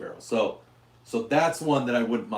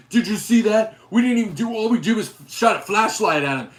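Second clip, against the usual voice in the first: high, indignant fake innocence; mocking protester voice; 9 semitones higher, 6 dB louder than usual. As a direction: act wronged and innocent, much higher. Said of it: "And they're like".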